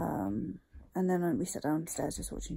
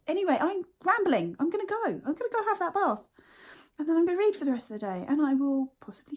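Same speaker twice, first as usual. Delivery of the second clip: chirpy sign-off; 10 semitones higher, 4 dB louder, faster than usual. Real, not acted. Bright sign-off, voice jumps up.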